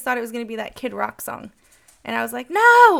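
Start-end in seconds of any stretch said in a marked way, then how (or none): 2.5-3.0 loud, high, drawn-out "No!"; emphatic retold protest. Teacher correcting kids about the song, loud protest. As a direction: cry out in exaggerated protest, much higher and louder.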